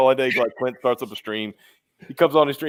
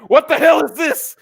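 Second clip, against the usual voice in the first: high-pitched outraged question; outraged disbelief; 5 dB louder than usual. Retold or imitated. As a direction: squeal it in disbelief. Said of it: "I'm like".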